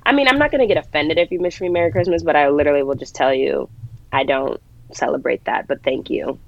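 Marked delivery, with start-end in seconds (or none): none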